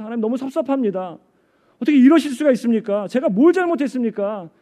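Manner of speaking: high, hurt complaint to God; aggrieved pleading. Retold or imitated. Pastor's wife pouring out her hurt to God, aggrieved. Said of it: "막 하소연하면서".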